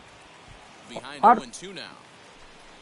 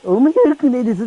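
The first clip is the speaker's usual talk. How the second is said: mocking manager; 4 semitones higher than usual. Manager mocks player's excuse.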